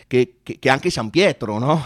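usual speaking voice

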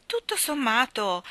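ordinary delivery

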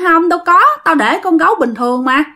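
imitated surprise, starting high and easing down; retold startled denial. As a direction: act out a puzzled friend insisting otherwise. Acted startled denial to friend.

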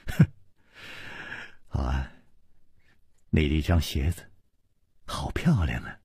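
low, hushed near-whisper; soft-spoken. Acted. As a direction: breathe it softly, teasing. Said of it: "轻声说".

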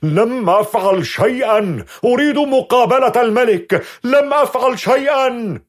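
high, loud, desperate shouting. Acted. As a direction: yell it at the walls. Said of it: "يضرب الجدران بكفه ويصرخ".

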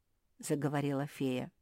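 ordinary delivery

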